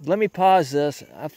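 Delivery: ordinary talk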